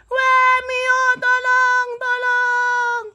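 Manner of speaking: high, drawn-out wail for help; panicked cry for help. Acted. Frightened player begs Mio for help, panicked wailing. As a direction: stretch each plea into a long wail. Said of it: "kok teriak-teriak gitu".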